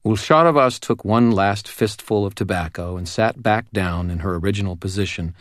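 ordinary talk

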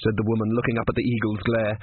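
ordinary delivery, nothing marked

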